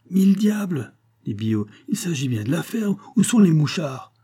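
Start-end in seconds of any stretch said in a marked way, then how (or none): 0.1-0.9 high, raised exclamation; indignant farmer's outburst; angry oath. A narrator's reading. Farmer curses at Pitou. 1.9-4.1 high, louder indignant retort; heated farmer brushing it aside; impatient demand. A narrator's reading.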